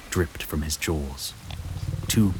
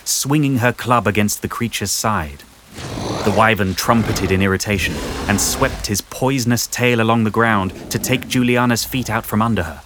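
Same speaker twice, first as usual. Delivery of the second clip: plain reading voice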